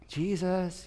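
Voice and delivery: soft, quiet call of the name; gentle wake-up. Retold or imitated. Preacher shows the wrong way to wake Jesus, softly. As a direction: coax him awake like a sleeping child. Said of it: "you know how you wake up your children".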